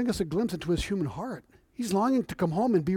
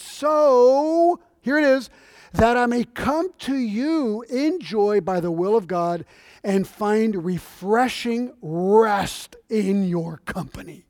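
slow emphatic scripture reading; 5 dB louder, much slower than usual. Acted.

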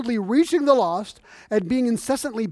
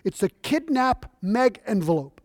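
ordinary talk

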